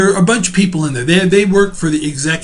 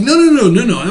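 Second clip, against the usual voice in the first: higher, louder rapid reassuring protest; urgent denial; 8 semitones higher, 6 dB louder than usual. Retold or imitated. Teacher retelling calming his neighbor, urgent denial. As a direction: rush to take back the insult.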